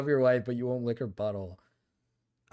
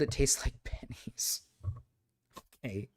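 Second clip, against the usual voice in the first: whispering; 4 semitones lower, 8 dB quieter than usual. Real, not acted. Quiet aside while reading chat.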